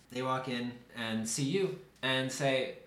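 normal talking voice

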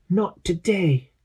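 slow, high declaration; Quasi's defiant cry. Acted. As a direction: say it like a hero's last stand.

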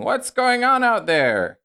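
high, loud, drawn-out holler; calling out. Acted. Neighbour yelling from the house across the alley, high call. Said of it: "somebody going".

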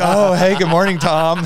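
very high, loud swooning hello; delighted flirty reply. Retold or imitated. Amy answering Tom Brady, high mock swooning. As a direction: melt as you say his name. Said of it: "And she's like".

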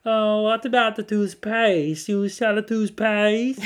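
put-on slow, loud, high Polish accent; drawn-out foreign caller, Polish accent. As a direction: stretch out each question as the Polish prank caller. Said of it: "And I'd go".

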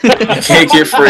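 laughing through the words. Real, not acted. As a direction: say it while cracking up. Laughing mock.

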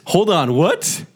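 slow, high, disbelieving; incredulous. Real, not acted. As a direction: stretch out the disbelief. Friend hasn't seen the show.

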